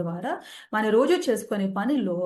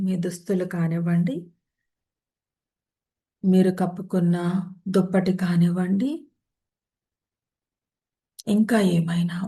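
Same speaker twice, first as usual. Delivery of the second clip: guided meditation; 4 dB louder than usual. Acted. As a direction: ease listeners into rest, one unhurried phrase at a time. Leads a yoga nidra session.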